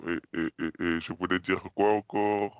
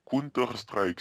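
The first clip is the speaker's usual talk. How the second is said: says it slowly and deliberately on request; slower repeat; much slower than usual. Pretend. Prank caller repeats for the hotel clerk, slowly. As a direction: drag out Counter-Strike for the confused clerk. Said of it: "Vous pouvez répéter un peu plus lentement?".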